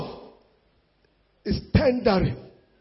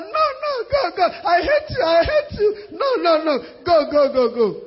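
high, wailing no no, go go; mock tantrum wife; 5 semitones higher than usual. Wife acts a crying fit, sending her husband away. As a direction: shoo him away in a hammed-up sob. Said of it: "enter her room and bang the door".

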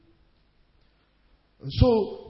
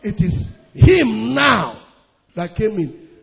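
loud climactic cry; preaching shout; 10 dB louder, slower than usual. Real, not acted. Preacher shouting to the congregation it is God. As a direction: shout it out to the church.

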